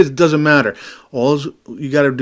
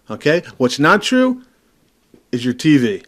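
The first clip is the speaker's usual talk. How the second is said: normal talking voice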